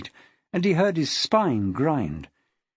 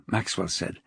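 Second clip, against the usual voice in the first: usual speaking voice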